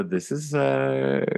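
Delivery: ordinary talk, nothing marked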